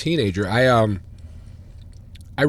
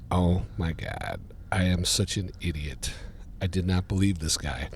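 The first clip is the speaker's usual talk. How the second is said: rueful self-talk; 4 semitones lower, 7 dB quieter than usual. Retold or imitated. Fan reading the Tribune, quietly cursing himself. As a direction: kick yourself quietly.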